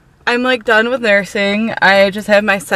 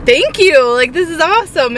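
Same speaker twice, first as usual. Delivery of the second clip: excited thanks; 8 semitones higher than usual. Retold or imitated. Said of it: "I was just like".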